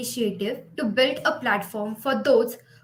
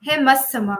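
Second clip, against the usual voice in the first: poetic recitation; 8 dB louder, much faster than usual. Acted. Host opens the slam poetry competition, speaking like a poet. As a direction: open loud like a poet, then ease off. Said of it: "why am I speaking like a poet".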